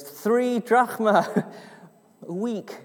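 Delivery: acted hesitant answer, drawn out. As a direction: offer it uncertainly, with a chuckle breaking in. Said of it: "him quite hesitantly goes".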